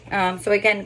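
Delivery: ordinary talk, nothing marked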